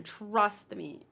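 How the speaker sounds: ordinary talk, nothing marked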